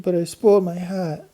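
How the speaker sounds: put-on a child protesting through tears; tearful child. A narrator's reading. Babs answers Mary.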